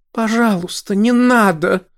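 high, desperate plea; pleading cry. Acted. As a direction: beg him to stop, voice up high. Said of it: "взмолилась она". (высокая отчаянная мольба)

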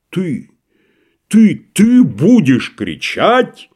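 slow, outraged disbelief; mock outrage, slowly; affronted. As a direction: throw his word back at him. Tragedian affronted by merchant.

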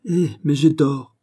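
huffy, drawn-out protest; offended. Acted. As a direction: play the man who's been slighted. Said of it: "dit D'Artagnan comme un homme fâché".

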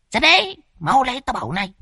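put-on high, fast comic old man voice; hurried old man. Old Đồng pulling Phọt aside to ask for money, high and fast. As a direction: hurry him over in a squeaky old voice.

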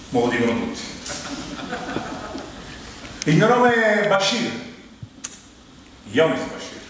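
ordinary delivery

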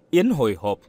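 usual speaking voice

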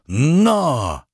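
a short urging cry to the horse; shouting. A narrator's reading. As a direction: call out to spur the horse on. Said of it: "крикнул".

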